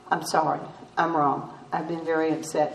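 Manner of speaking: apologizing to husband, hushed and sheepish; quiet apology. Retold or imitated. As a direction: say it low and humbled.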